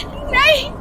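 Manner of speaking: very high, pleading scream; terrified screaming. A narrator's reading. Mother-to-be begging attackers to spare her baby, screaming in terror. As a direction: scream a desperate plea, pitched right up. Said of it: "मैं जोर से चीखी".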